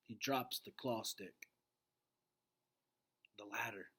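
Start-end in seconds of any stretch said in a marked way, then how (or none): 3.4-3.9 low, quiet surrender; father finally agreeing under his breath; quiet giving in. A narrator's reading. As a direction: concede quietly, worn down. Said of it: "he says quietly".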